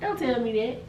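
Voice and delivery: normal talking voice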